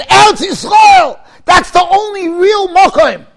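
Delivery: loud, high, slow declaring; rabbi's proclamation. Retold or imitated. Quoting Rav Gifter to the listeners, a loud slow proclamation. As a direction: proclaim it like a rabbi thundering from the pulpit. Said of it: "he said".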